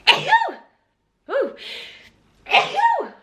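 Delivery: high shriek of disgust; disgusted squeal. Real, not acted. After a bitter sip, a high disgusted squeal.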